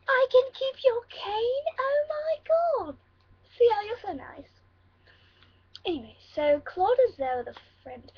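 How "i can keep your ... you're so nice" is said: slow, high-pitched gushing thanks; delighted surprise. Pretend. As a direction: gush in drawn-out, high amazement. Delighted at offered cane.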